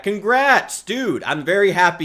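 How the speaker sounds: high, loud congratulations; excited. Real, not acted. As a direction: cheer for your friend. Friend got vision back.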